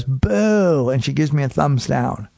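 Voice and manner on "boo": drawn-out boo, slightly raised; retold jeering boo; heckler's boo. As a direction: imitate a heckler booing from the front row. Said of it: "the girl in the front row goes".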